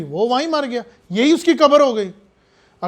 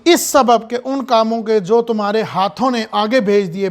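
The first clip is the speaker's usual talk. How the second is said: ordinary talk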